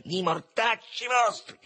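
high, slow-stretched angry shout; furious yell. A narrator's reading. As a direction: lash out at whoever did it. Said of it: "urlò voltandosi furente". (urlo furente, acuto e scandito)

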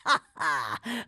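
short acted chuckle; old schemer's laugh. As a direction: laugh with exaggerated relief.

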